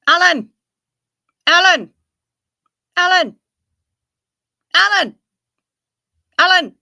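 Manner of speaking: loud high calling out, name shouted. Pretend. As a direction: call out across a field. Calling out to a friend.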